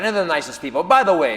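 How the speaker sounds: usual speaking voice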